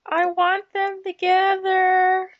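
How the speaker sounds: high, drawn-out fan wail; playful longing. Real, not acted. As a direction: stretch it out, pining for the couple. Fan voices wish for the couple.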